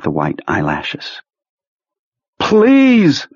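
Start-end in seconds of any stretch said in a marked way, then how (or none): 2.4-3.3 high, drawn-out cry of please; screamed plea. A narrator's reading. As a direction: cry out for mercy, far above your usual pitch. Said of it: "Pete screamed".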